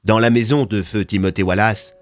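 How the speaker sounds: usual speaking voice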